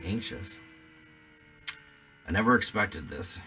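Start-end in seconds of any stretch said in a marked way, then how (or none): none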